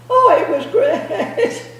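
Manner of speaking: ordinary delivery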